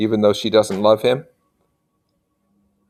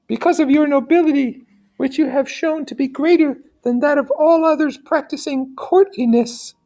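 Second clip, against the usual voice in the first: lady's pleading voice. Acted. Teacher voicing the lady begging Federico, high and pleading. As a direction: beg him in a high, genteel lady's voice.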